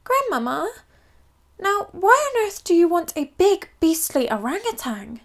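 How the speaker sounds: put-on high childlike voice, baffled; a little royal child asking Grandmama; royal baby. Royal baby questioning Grandmama about the orangutan. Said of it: "the royal baby spoke up".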